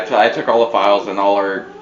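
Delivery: usual speaking voice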